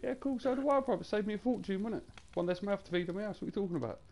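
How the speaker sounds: high, sarcastic breezy reply; scoffing retort to a troll; mocking comeback. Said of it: "I said".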